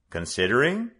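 Considering lifted as a probing question; curious echo. A narrator's reading. The father questions the word.

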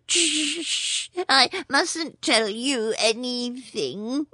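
soft, slow, fearful hush; frightened hush. Acted. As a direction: hush as if the snake could hear. Frightened rat hushing.

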